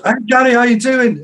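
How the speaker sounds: calling out. Retold or imitated. Stranger hailing Gary at four a.m., loud call. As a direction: call across the empty street.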